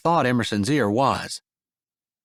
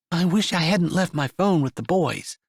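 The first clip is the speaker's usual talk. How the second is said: higher, lighter female character voice; woman's voice; 6 semitones higher than usual. Acted. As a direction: voice Emerson a little higher. Woman character speaks in story.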